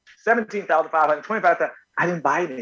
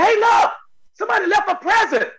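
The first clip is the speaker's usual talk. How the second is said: high, loud, thrilled call; excited discovery; 3 dB louder than usual. Retold or imitated. Telling friends he found a present, high and thrilled. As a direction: announce the find with delight. Finding a gift on the street.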